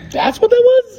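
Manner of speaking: very high, loud disbelieving question; incredulous. Real, not acted. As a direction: squawk it in mock astonishment at the bad impression. Disbelief at failed impression.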